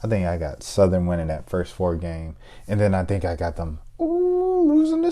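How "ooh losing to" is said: pained ooh. Real, not acted. Wincing at own pick.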